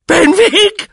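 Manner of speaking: piercing, high cry as if stabbed; very high, loud shriek of a question. A narrator's reading. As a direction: scream it in wounded disbelief. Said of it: "schrie der Onkel, als habe man ihm einen Dolchstoß versetzt".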